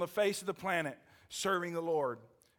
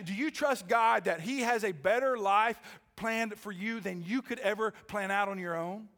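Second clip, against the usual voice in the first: usual speaking voice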